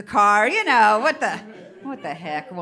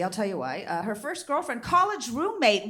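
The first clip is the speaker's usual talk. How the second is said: usual speaking voice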